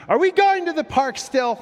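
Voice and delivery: put-on high, loud little-boy yell; kid yelling. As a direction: imitate a backseat kid shouting. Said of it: "keeps yelling from his driver's seat".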